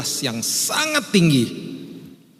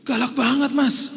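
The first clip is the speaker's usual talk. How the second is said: much higher, amazed tease; surprised remark; 13 semitones higher than usual. Retold or imitated. Preacher teasing the driver, high and amazed. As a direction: gasp it to the driver.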